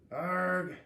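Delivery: loud frustrated yell; angry yell. Acted. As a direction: cry out in a meltdown. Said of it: "James was yelling".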